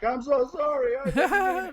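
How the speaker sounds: high, loud mock pleading; mock begging apology. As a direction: grovel in an exaggerated high voice. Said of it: "dropped to my knees and been like".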